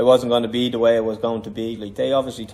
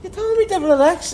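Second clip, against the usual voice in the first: high, louder whiny comeback; retold protest to the coach, pitched way up and louder; mock indignant protest; 5 dB louder than usual. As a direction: play it like a wronged kid protesting.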